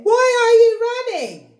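high, loud goose voice; Goosey Lucy voice. Acted. Goosey Lucy asking the running birds, high and loud. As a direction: ask in alarm.

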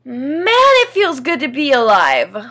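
bursting with gratitude for life; a loud, euphoric exclamation; overwhelmed joy. Real, not acted. Overwhelmed joy at being alive.

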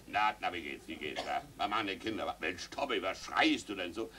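low, soft, hearty uncle; lightly voiced uncle Adolf saying hello; jovial uncle. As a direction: greet the children like an old uncle. Uncle greets family.

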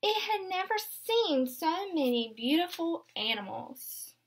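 gentle picture-book read-aloud; storybook narration for little ones; reading to children. Acted. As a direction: read it aloud to a class of young kids. Teacher reads picture book aloud.

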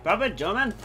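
high, failed attempt at German accent; badly faked German accent. Drinker praising his beer, badly faked German accent. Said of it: "that doesn't sound German".

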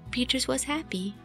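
normal talking voice

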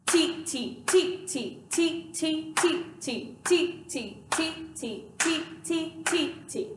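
T T spoken as two even notes per beat; rhythm syllable demo. Shown as a demonstration. Teacher speaks ti-ti while clapping the beat, even rhythmic chant. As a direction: chant T, T evenly in strict time. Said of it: "I will speak T T and clap the beat".

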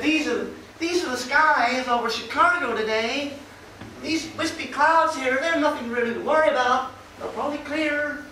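put-on American accent. Imitating the Fox News presenter in an American accent. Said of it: "Sorry about the accent".

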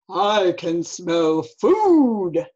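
drawn-out, booming storybook bear yell. Acted. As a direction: boom it out slowly like a big hungry bear. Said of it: "yelled a voice from nearby".

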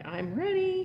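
nervous. Acted. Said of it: "he said nervously".

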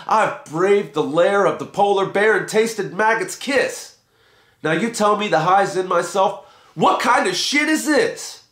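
Roy rages at the guru over his lost fingers and toes; angry rant. A narrator's reading. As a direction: vent every hardship at him. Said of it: "screamed Gimme Some Roy".

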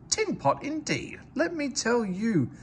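high-pitched offended engine snapping back; indignant engine. A narrator's reading. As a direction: bristle at the insult. Engines bickering.

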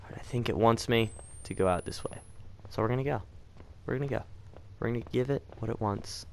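soft, hushed voice while sneaking; hushed, cautious. Real, not acted. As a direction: keep it quiet so it won't hear you. Sneaking past the monster.

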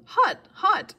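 high, loud yelps; pained yell. A narrator's reading. Grover rips away the burning whip, yelling in pain. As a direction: yelp each word like touching a hot stove. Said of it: "he yelled".

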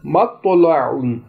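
मत तो लाउन with a thick bold jolted t; heavy emphatic to. Shown as a demonstration. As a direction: give तो in मत तो लाउन a heavy, full t. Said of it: "मोटी आवाज़ है, बोल्ड है और झटके".

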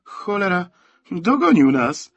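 high, loud cry of admiration; loud amazed outburst, stretched at the end; amazed exclamation. A narrator's reading. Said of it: "wykrzyknął z podziwem".

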